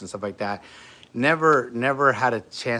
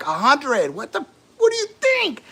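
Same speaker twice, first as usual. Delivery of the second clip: much higher and louder, strained outburst; mock pained yell; 5 dB louder than usual. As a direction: yell your pain score at the nurse. Retold pain, snapping at nurse.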